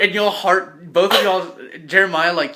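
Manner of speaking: normal talking voice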